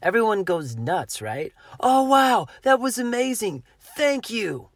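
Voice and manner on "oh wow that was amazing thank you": high, loud, thrilled shout; excited cheering. Retold or imitated. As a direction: cheer like you just saw a rescue. Rafters cheering after rescue.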